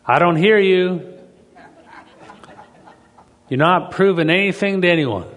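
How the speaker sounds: loud and high, calling back across the house; husband yelling back at his wife from the other room; yelling from afar. Retold or imitated. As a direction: shout it from the other side of the house. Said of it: "he'd yell back at her".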